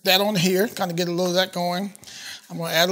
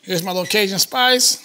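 ordinary talk

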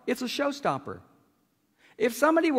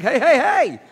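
loud, higher panicked hey; alarmed yelp; 5 semitones higher, 10 dB louder, slower than usual. Retold or imitated. Larry yelping as one side drops too fast. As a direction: cry out as the mat tilts.

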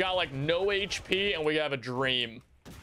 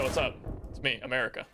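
ordinary delivery

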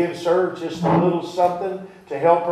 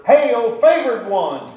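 slow, higher and louder hail; exclamation, not whispered; 7 semitones higher, 5 dB louder, slower than usual. Shown as a demonstration. Angel greeting Mary in her room, called out slow and loud. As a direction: call it out boldly as the angel. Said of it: "there's an exclamation point, so he didn't whisper it".

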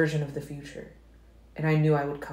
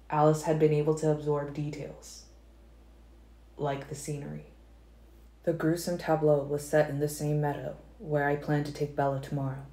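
ordinary delivery

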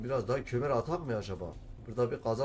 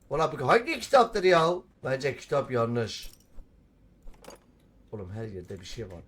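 ordinary delivery

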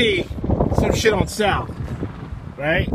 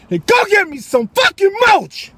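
high loud yelled order; mock wife yelling; 10 semitones higher, 5 dB louder than usual. Wife demanding he fetch the mulch, shouting. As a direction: explode on the last demand. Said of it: "She said".

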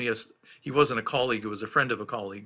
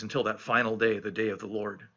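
normal talking voice

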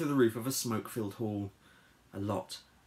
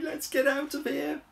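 high-pitched frantic plea; urgent cry; 4 dB louder than usual. Acted. Harold fleeing the hatchlings, panicked cry. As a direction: cry it out in a hurry. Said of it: "he cried".

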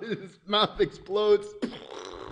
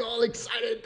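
ordinary delivery

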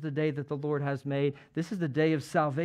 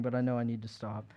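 ordinary delivery

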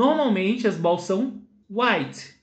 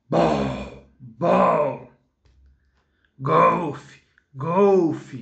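monster voice; 4 semitones lower, much slower than usual. Shown as a demonstration. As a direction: lower growling monster. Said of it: "Vamos fazer uma voz de monstro".

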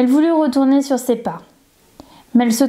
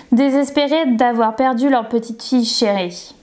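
usual speaking voice

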